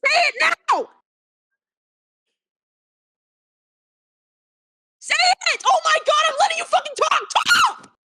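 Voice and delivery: sobbing, furious demands; crying shout. Real, not acted. Girlfriend demanding answers, shouting and crying. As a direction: yell it through tears.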